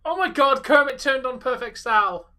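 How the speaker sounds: mock commentator shock; excited. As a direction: call it like a stunned announcer.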